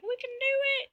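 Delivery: squeaky archers. Retold or imitated. The archers cheer each other on in a squeaky voice. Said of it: "these archers like".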